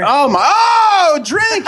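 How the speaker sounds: excited. Real, not acted. Drinking-word game call.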